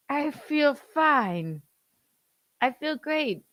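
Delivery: high, slow put-on Pope voice; frail Pope voice. Son dubs the Pope insisting he feels fine. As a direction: squeak it slowly like a frail old man.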